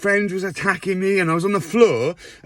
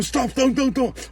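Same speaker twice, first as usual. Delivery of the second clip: higher, panicky begging to stop; frightened pleading; 6 semitones higher, slower than usual. Retold or imitated. Strapped to a hospital bed, begging the nurse, frightened high plea. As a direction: plead like they're about to kill you. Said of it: "And then I was going".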